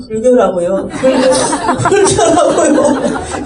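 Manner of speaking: drawn-out, laughing 불교라고요, half mock; exasperated, laughing. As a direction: roommate fed up, breaking into laughter. Exasperated roommate, teller laughing. (짜증 섞인 웃음으로 길게)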